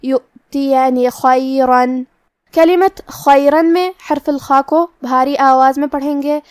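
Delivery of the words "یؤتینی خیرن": یؤتینی with the hamza clearly voiced and the final نون read with a plain zer; slow tajweed demo. Shown as a demonstration. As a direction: recite یؤتینی خیرن slowly, every vowel distinct. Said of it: "صرف زیر سے پڑھیں گے".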